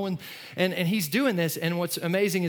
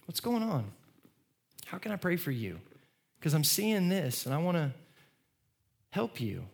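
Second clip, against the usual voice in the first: put-on soft, tender check-in with his child; gentle dad; 4 dB quieter than usual. Father on his child's bed, softly gentle. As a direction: ask quietly like a caring father. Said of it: "I'll be like".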